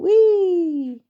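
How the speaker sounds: high, loud, drawn-out squeal of glee; playful high whoop; gleeful whee. A narrator's reading. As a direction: call it out like a child swinging on a door. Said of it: "giggling, going".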